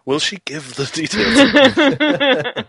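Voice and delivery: hammed-up voiceover, drawn out with a laugh; movie trailer voice. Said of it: "lame impressions of, uh, trailer voiceover people".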